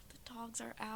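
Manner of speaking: whispered aside. Real, not acted. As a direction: breathe it as an aside. Whispered joke.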